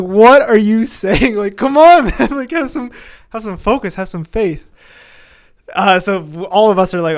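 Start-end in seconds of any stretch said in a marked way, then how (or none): 0.0-4.7 high, loud and laughing, can't believe it; begging a teammate to believe in himself; mock outraged pleading, much higher; exasperated disbelief. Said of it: "We're all like".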